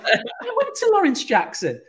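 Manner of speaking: high, loud, laughing incredulity; retold disbelief. Traveller finding they went to the same school, amazed. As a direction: blurt it out astonished, through laughter. Retelling shock at shared school.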